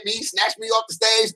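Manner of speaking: usual speaking voice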